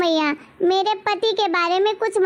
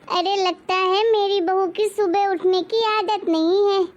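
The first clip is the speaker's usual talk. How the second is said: ordinary talk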